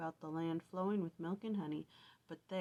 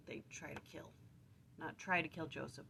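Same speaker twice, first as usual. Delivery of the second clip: ordinary talk, nothing marked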